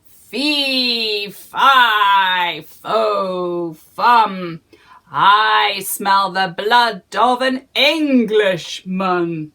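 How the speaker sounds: loud, slow booming giant. Acted. Giant sniffing for Jack, booming and slow. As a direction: boom it like a hungry giant. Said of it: "he said".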